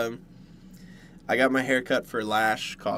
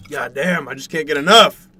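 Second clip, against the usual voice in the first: excited; 2 semitones higher, 11 dB louder than usual. Retold or imitated. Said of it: "And now I'm like".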